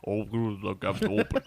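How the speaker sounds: slow, low, hammed-up Liverpudlian; thick Liverpudlian accent. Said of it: "like, Liverpudlian accent".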